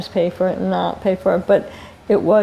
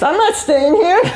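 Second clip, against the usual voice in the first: high-pitched mock protest; alarmed refusal; 9 semitones higher, 4 dB louder than usual. Colleague standing on the anaconda refuses to stay, mock alarm. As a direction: imitate an alarmed refusal, half laughing.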